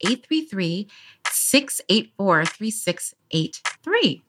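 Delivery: each digit spaced out slowly; slow down so they can write it; slow and clear. Real, not acted. Slowly reading out the voicemail number.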